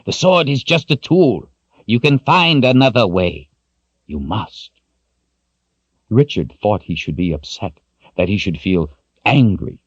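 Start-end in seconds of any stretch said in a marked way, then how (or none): 0.0-4.7 put-on stern old wizard. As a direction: lay down the hard rule to him. Zed insists.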